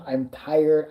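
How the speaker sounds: ordinary talk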